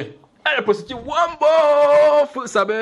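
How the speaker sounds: high, loud, slowed jeer; mocking jeer. Father scolding the prodigal son, mock jeering. Mocking the son's spending on prostitutes.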